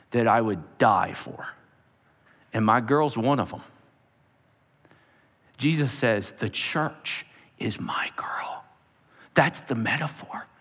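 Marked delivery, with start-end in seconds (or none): 6.3-8.6 soft, slow, near-whispered devotion; hushed and adoring; tender hush. Retold or imitated. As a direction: say it slowly, almost under your breath. Quotes Jesus, tender hush.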